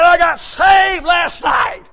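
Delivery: excited shout. Retold or imitated. Friend's phone call, retold.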